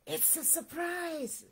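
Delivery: high, soft hush; whispered. A narrator's reading. Mouse letting Fox in on the surprise, softly whispered. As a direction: share a secret softly. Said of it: "whispered Mouse".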